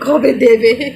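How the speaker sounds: playful call to the little one. Real, not acted. Mother coaxing baby.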